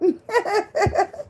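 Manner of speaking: squealing delighted laugh; giddy high laugh. Real, not acted. Laughing over the boyfriend's accent, squealing.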